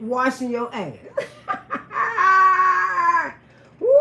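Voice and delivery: normal talking voice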